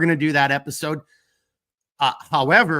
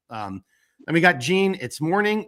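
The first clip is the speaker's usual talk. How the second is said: ordinary talk, nothing marked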